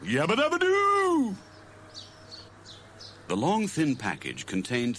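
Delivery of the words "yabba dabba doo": high, loud slow cartoon yell of joy; Fred's whoop. A narrator's reading. Fred celebrates his new fishing kit, loud cartoon whoop.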